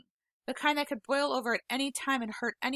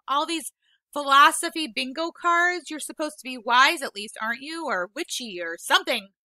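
scornful back talk; 4 semitones higher, 8 dB louder than usual. Acted. Peter back-talking Vola.

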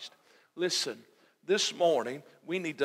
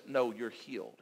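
lower, softer reassurance; still small voice; 4 semitones lower, 6 dB quieter than usual. Acted. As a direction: drop to a soft, low, gentle answer. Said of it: "that little small voice simply says".

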